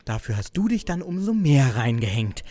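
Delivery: quiet, low sneer at her colleague; low contempt. Acted. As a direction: drip disdain down low. Jane bitterly recounts the karaoke.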